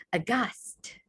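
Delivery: breathless unvoiced whisper of horror; horrified whisper. Shown as a demonstration. Teacher shows what aghast means by whispering it in horror. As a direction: gasp the word out in shock. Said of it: "the way I'm saying it".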